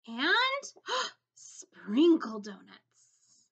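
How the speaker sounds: slow, wide-eyed gasp before the words; excited gasp. Acted. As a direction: reveal the sprinkle donuts like a treat. Teacher reveals new donuts.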